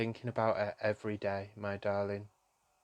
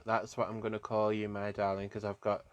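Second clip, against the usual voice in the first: ordinary talk, nothing marked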